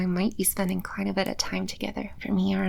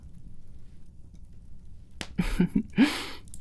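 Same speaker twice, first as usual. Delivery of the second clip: a quiet, airy demon laugh; soft breathy chuckle; 2 semitones higher, 6 dB quieter than usual. Acted. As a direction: chuckle under your breath, amused.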